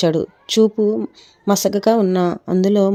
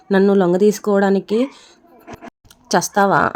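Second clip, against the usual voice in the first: a narrator's understated yell; lightly acted angry shout; mild shout. A narrator's reading. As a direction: raise the voice a little in anger. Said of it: "అని గట్టిగా అరిచాడు రిషి".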